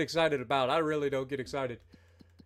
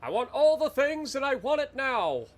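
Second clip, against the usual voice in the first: greedy customer; 9 semitones higher, 5 dB louder than usual. Pretend.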